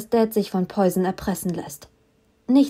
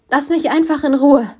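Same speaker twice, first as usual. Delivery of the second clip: much higher and louder, fierce shout; angry shout; 6 semitones higher, 6 dB louder than usual. A narrator's reading. She shouts at Poison to leave her alone. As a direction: push him away with your voice.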